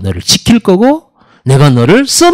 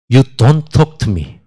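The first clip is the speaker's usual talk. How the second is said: very slow, drawn-out English with a laugh; mock English retort; 6 semitones lower, 4 dB quieter, much slower than usual. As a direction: stretch every word, amused. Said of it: "막 해요". (웃으며 아주 느리게 늘어뜨린 영어)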